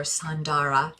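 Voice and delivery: "Sandara" said as sahn-DAR-uh, three syllables; teasing nickname. Shown as a demonstration. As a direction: stretch the name into a mock-fancy "Sandara". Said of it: "When I tease her, I call her".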